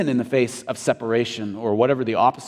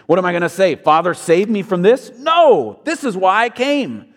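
wry rhetorical question, then a high burst; sarcastic; 5 semitones higher, 4 dB louder than usual. Retold or imitated. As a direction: toss it off with sarcasm. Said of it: "He asked almost sarcastically".

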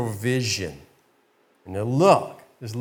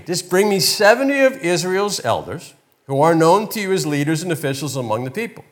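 usual speaking voice

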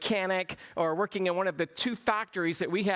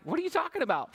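high-pitched, incredulous reenactment; pitched-up mock confusion; mock bafflement; 8 semitones higher, faster than usual. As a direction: play up disbelief at the waiter. Retold baffled reply to waiter.

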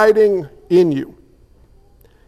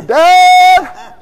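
put-on high, loud child's cry for mom; panicked yell; 9 semitones higher, 7 dB louder than usual. Little boy yelling to mom from the pool. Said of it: "I'd start yelling".

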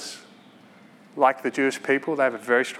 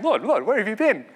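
eager crowd; 6 semitones higher than usual. Acted. Crowd chasing Jesus after feeding.

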